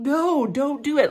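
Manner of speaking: half-playful warning to a cartoon; mock plea. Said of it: "I'm just thinking".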